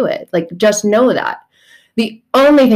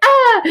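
mock anguished cry; 4 dB louder than usual.

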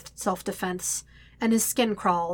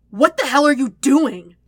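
loud, raised-pitch outrage; outraged shout; 6 semitones higher, 10 dB louder than usual. A narrator's reading. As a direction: shout it in angry disbelief. Bursts in on an attack.

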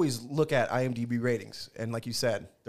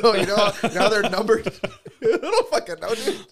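laughing; 7 semitones higher, 9 dB louder than usual. Real, not acted. Laughing at own joke.

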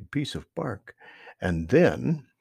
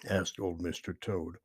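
usual speaking voice

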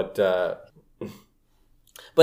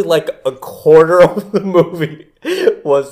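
laughing; 8 semitones higher, 8 dB louder than usual. Real, not acted. Gushing about long pod race.

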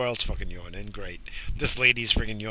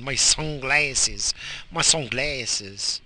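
drawn-out sunglasses; 3 semitones higher, 4 dB louder, much slower than usual. Pretend. Slow drawn repetition.

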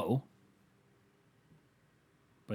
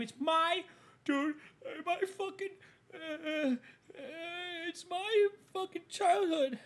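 much higher, slow whiny mock-crying; whiny upset fan. Comedian mocking a baseball fan upset about the team name. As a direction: lampoon a fan whining about his childhood team.

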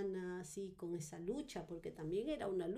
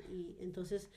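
ordinary talk, nothing marked

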